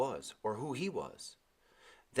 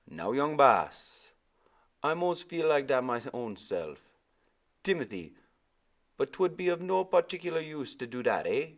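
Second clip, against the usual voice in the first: soft musical calypso; 7 semitones higher, 4 dB louder than usual. A narrator's reading. Old sailor soothing the weeping boy. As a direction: comfort the crying boy gently. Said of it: "His voice was rich, calypso soft and musical".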